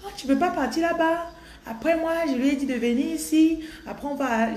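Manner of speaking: high, put-on Gabonese accent; teasing imitation of Gabonese French. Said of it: "ils ont oublié l'accent camerounais".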